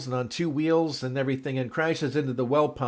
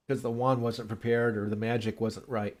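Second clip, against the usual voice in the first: ordinary talk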